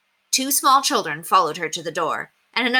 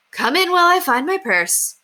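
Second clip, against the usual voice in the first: busy mother; 12 semitones higher, 5 dB louder than usual. A narrator's reading. Miss Kelly voiced.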